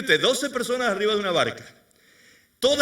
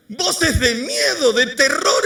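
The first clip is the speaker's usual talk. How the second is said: slow, loud and higher; dramatic fear words; 5 semitones higher, 7 dB louder, much slower than usual. A narrator's reading. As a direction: hammer it out with a laugh.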